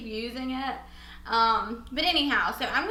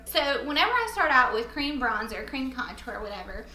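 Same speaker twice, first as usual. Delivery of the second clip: ordinary delivery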